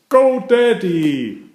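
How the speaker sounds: high, slow, drawn-out cheer; excited cheer. A narrator's reading. Peppa and George clapping for Daddy, drawn-out excited cheer. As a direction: cheer Daddy on, stretching each word.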